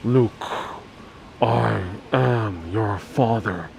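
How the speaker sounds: slow, low mock Vader rumble; Darth Vader. Vlogger quoting Star Wars for chat, slow low voice. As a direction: drag it out, deep and menacing.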